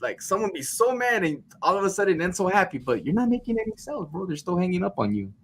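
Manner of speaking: high, animated workplace critique; marvel, then complain to the salesman; imitated baffled boss. Imitating bosses critiquing him.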